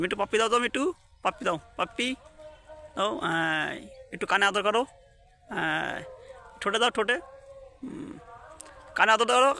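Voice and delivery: high, sing-song coaxing of a pet bird; pet talk. Real, not acted. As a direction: sweet-talk the parrot into a kiss. Coaxing her pet parrot.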